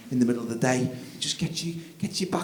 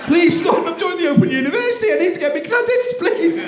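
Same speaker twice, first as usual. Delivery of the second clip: high, loud desperate pleading; begging dad; 7 dB louder than usual. Retold or imitated. Tired dad begging his toddler to nap, high desperate pleading. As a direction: beg like your life depends on it.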